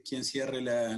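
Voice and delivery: usual speaking voice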